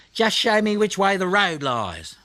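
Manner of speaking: high, cocky little voice; bold young koala. A narrator's reading. Blinky asking Snubby the way, high and cocky. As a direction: brave boy showing off.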